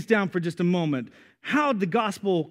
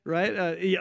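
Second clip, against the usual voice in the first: ordinary talk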